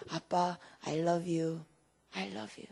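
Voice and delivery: low, soft endearment; tender reply to dad. Retold or imitated. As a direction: quote daughter's love softly. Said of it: "저도". (아빠에게 낮고 부드럽게 전하는 사랑 고백)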